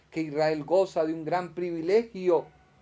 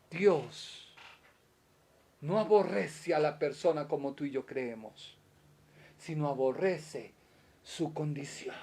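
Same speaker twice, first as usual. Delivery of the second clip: ordinary talk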